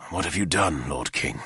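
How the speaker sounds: subdued, heavy with restraint; quiet. Acted. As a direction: Arthur asking again, low and quiet. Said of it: "he asked again".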